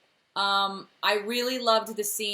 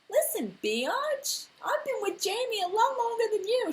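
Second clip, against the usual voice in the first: hammed-up jealous old friend putting her in her place; Murtaugh, mock indignant. Podcaster voicing Murtaugh's imagined retort to Claire, mock indignant. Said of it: "a part of Murtaugh that's like".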